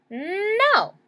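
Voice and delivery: put-on high, loud childish "No."; a child's indignant no; sharp refusal. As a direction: snap back a flat no. Stephanie refusing mom.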